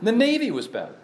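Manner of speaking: indignant echo; incredulous repeat. Real, not acted. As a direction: repeat it in disbelief. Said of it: "Can you believe that?".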